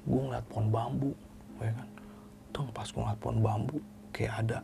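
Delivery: soft unvoiced whisper. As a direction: hush it like telling a ghost story. Whispered ghost sighting. (berbisik pelan seperti cerita hantu)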